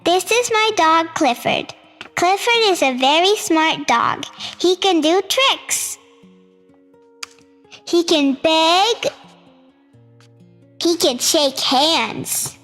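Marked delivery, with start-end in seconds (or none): none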